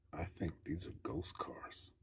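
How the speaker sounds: hushed, breathy fear, barely voiced; scared whisper. Uncle whispering his fear of ghost cars, hushed. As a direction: whisper it like the ghosts might hear.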